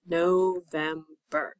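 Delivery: very slow, split into its syllables November. Shown as a demonstration. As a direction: stretch November out slowly, one syllable at a time. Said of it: "How many syllables is November".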